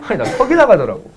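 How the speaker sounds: ordinary talk, nothing marked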